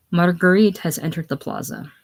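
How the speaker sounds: normal talking voice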